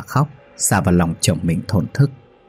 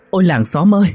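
fake wailing shout; 8 semitones higher than usual. A narrator's reading. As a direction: wail it out to alert the neighbours. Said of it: "bù lu bù loa gân cổ hét lên thật lớn". (gào khóc giả vờ thật lớn)

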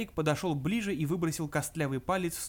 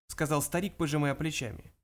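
ordinary delivery, nothing marked

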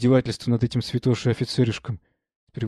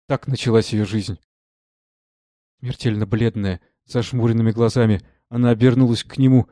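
ordinary delivery

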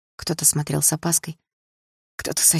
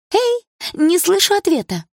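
very high, loud, drawn-out jeer; taunting demand; 5 dB louder than usual. A narrator's reading. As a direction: needle her for an answer in a shrill voice. Rival girl demands an answer. (визгливая издёвка)

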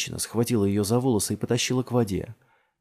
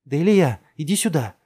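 put-on loud, high call to a child; raised voice, pitched up; 7 semitones higher, 7 dB louder than usual. A narrator's reading. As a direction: summon the child urgently. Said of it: "позвала Джессика".

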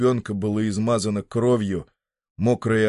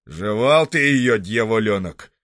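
медленно, громче, ворчливо; ворчи, разглядывая бумажку; лёгкий голос хозяина; 5 dB louder than usual. Acted. (хозяин ворчит на мальчика, медленно)

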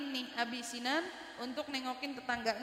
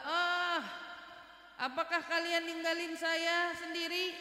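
raised, pleading cry of the dead; crying out from the grave; 4 semitones higher, slower than usual. Retold or imitated. Dead man to the brother he fears will leave, pleading cry. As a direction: imitate a buried man calling out, higher. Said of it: "ada teriakan suara kayak gitu".

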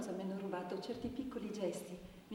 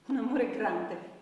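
slower and louder, full of longing; heartfelt declaration; 9 dB louder, slower than usual. Acted. The woman telling the brigadier of a great lost love. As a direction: pour out the longing.

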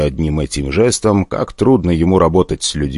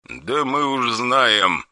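soft, cold menace; quiet threatening caller on the phone; dry harsh voice; 6 semitones higher, 7 dB quieter, much slower than usual. Acted. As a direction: threaten without raising the voice. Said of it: "отрезал жёсткий голос".

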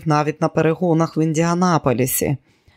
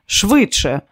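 higher and louder hurry-up; urgent; 5 semitones higher, 3 dB louder than usual. Acted. Diana urging Kelly before the car arrives, urgent. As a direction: push her friend to act now.

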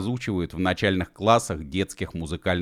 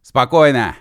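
raised, higher command; loud reply; 6 semitones higher, 10 dB louder than usual. Acted. Mashkov to alarmed Gedevan, loud and urgent. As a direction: shout back to settle him.

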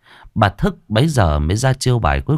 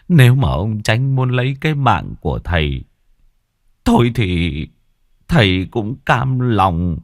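tearful father's plea. Acted. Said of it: "cúi mặt mà khóc mếu".

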